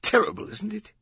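very high, disdainful tag question; scoffing. A narrator's reading. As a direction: scoff at the awfulness of it. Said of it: "the docent scoffed".

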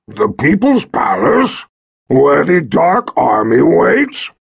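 slow, loud doubtful creature voice; wary dragon. Acted. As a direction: rumble it with suspicion. Dragon voice held.

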